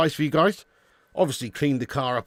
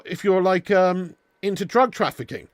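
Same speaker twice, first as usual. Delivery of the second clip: usual speaking voice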